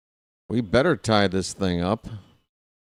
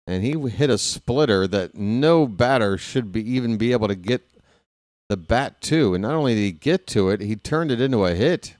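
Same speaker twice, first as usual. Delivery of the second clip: ordinary talk, nothing marked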